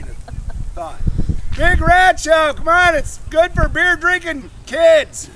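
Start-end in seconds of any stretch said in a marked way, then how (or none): 1.6-5.3 loud, high show pitch, stretched out; barker's shout. Pretend. Performer hawking the rat show to passers-by, calling out. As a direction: holler to drum up a crowd.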